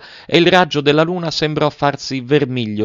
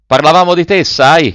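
high, loud, eager exclamation; lively raised voice, pitched high; exclaiming; 8 semitones higher, 8 dB louder than usual. A narrator's reading. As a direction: burst out to a friend who has just arrived. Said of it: "esclamò Adele".

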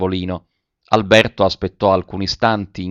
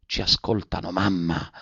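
hushed, urgent plea to her mother; soft pleading whisper-like voice; 2 semitones lower, 7 dB quieter than usual. A narrator's reading. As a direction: beg softly, afraid of being overheard. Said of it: "esclamò con accento supplichevole".

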